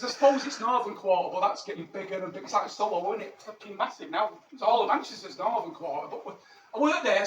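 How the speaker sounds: ordinary delivery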